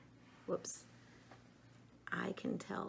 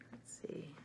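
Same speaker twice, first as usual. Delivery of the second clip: whisper; 13 dB quieter than usual. Real, not acted. Hushed while candling.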